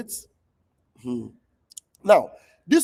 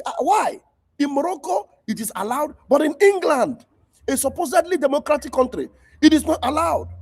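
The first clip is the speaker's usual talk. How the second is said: outraged disbelief; 7 semitones higher than usual. Pretend. Imagined outraged question.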